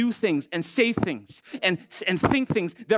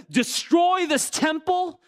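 slow, higher, almost shouted command; Jesus' command; 8 semitones higher, much slower than usual. Retold or imitated. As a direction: declare it slowly with force. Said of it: "Jesus says".

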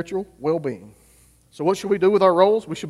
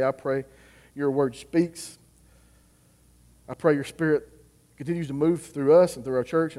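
prayer voice; 4 semitones lower, 5 dB quieter than usual. Acted. Pastor praying over his congregation, quietly. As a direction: pray it quietly and humbly.

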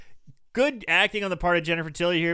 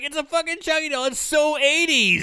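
high, loud, hammed-up derision; mock scoffing; 10 semitones higher, 4 dB louder than usual. Co-host plays Damien sneering at the doll, mock scoffing. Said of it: "scoffing at the doll".

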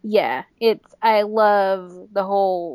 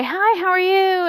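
high, friendly hello; imitated perky small talk; chirpy greeting; 9 semitones higher, 3 dB louder than usual. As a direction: greet a stranger brightly. Chirpy greeting to a stranger, retold.

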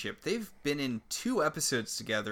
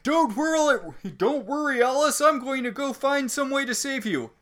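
high, loud shouted promise; mock heroic call; 12 semitones higher, 9 dB louder than usual. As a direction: call back urgently, imitating a departing hero. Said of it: "and he's like".

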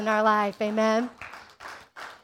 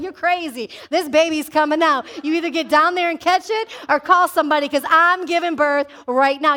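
louder, raised, pushing through; loud insistent mother; 4 semitones higher, 5 dB louder than usual. Retold or imitated. Preacher voicing a mother in labor, loud insistence. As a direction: yell it mid-labor. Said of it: "And she's like".

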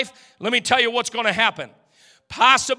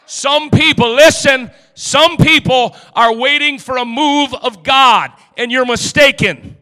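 loud shouted preaching; shouting preacher building to the close; preaching at full force; 3 semitones higher, 10 dB louder than usual. Acted. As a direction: hammer it home to the crowd.

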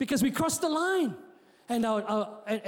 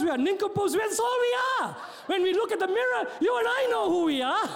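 high-pitched preacher's tirade; impassioned preaching; 6 semitones higher than usual. Real, not acted. Preacher owning up for himself and congregation, impassioned. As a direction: pile up the charges, pitched high.

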